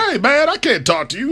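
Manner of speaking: a light take on Magic Johnson; Magic Johnson impression. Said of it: "I'm not sure that's what Magic Johnson sounds like".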